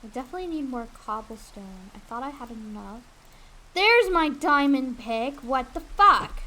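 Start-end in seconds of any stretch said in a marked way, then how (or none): 3.7-6.4 louder, slow shocked cry; startled outburst. Real, not acted. Streamer playing Minecraft reacts to a surprise in the game, loud and startled. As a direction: blurt it out, caught off guard.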